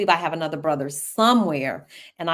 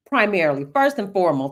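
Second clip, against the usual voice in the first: ordinary talk, nothing marked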